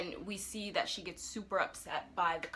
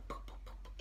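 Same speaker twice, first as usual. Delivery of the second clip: soft voiceless popping for gunshots; imitated gunfire; 13 dB quieter than usual. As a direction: mime quick shots with little puffs. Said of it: "he was like".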